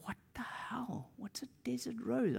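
high, hushed disbelief; baffled aside. Retold or imitated. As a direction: puzzle to yourself under your breath, softly. Acts his bafflement during prayer.

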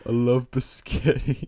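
slow dopey mumbler; dopey brother. Acted. Random head repeating himself, dopey voice. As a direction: drag it out dim-wittedly. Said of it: "like, the dopey brother".

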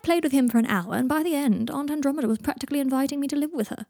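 lightly voiced Draco, pleased with himself; Draco recounting his visit.

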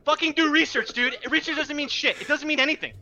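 high-pitched exasperated outburst; real exasperated rant. Real, not acted. Fantasy player ranting to co-hosts about a clueless trade partner, exasperated and high. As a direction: vent the frustration, pitch climbing. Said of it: "I'm so fucking pissed off about it".

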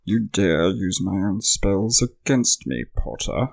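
slow British villain, throaty and nasal; Alan Rickman. Acted. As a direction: voice in the back of the throat and top of the nose. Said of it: "back of your throat and at the top of your nose".

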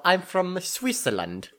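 high, slow mock foreigner saying where they're from; a touch of foreign tourist introducing themselves. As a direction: lightly play a proud foreigner naming a country. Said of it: "You know".

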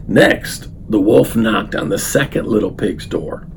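plain reading voice

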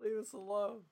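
small, high, soft mock plea; voice from the vault. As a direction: play a shut-in guest, high and quiet. Voicing guests shut in the vault.

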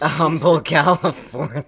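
laughing through the words; can barely say it for laughing. Real, not acted. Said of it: "sorry, I didn't mean to laugh so hard".

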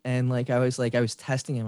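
usual speaking voice

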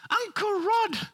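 high squeaky mock cousin's voice; panicked cousin. Preacher imitating cousin's frantic phone call, very high squeak. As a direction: pitch way up like a frantic kid on the phone.

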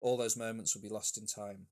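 usual speaking voice

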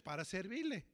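put-on Para servirle in a Spanish accent; Spanish courtesy phrase spoken in Spanish. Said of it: "Like they say in Spanish".

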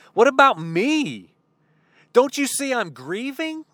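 a son pleading that he is grieving, climbing up high; grieving protest. Acted. Grieving son protests Jesus' call.

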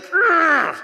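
high, loud strained urgh of self-will; sharp fed-up groan; frustrated growl. Shown as a demonstration. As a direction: let out a tight grunt of frustration. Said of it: "that constant, like".

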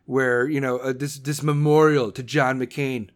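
a hint of a clueless politician thinking aloud; mock inner voice. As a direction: dim her thoughts down to a dopey murmur. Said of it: "she was thinking".

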